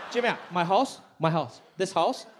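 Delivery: put-on loud accented mom bragging; lightly voiced pushy mom; imitating mom. Son imitating mom bragging about the house. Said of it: "And she was like".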